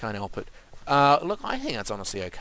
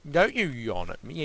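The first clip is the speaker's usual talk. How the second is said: normal talking voice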